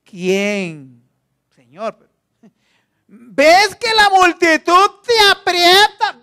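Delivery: slow, crying, high pleading voice; disciples' protest. Acted. Disciples to Jesus, slow wailing protest. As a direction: plead with the Master in a wailing cry. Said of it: "Sus discípulos le dicen".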